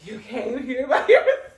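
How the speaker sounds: mock come-on, heavy puffed H on "here"; hard H's. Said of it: "why you saying all these hard H's".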